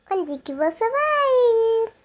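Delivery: voz de vovó aguda, bem lenta e mais alta; grandma voice. Pretend. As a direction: fala arrastada de vovó de brinquedo, aguda e alta. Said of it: "Nossa, que legal a voz dela". (vovó perguntando ao neto aonde ele vai, voz aguda e lenta)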